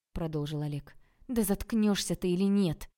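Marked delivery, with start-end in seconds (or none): 1.3-2.9 loud exasperated yell; angry shout. A narrator's reading. Tanya cuts Oleg off and kicks out, angry shout. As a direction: burst out loudly, out of patience. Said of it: "закричала Таня".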